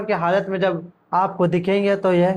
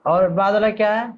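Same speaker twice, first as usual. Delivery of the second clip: ordinary talk, nothing marked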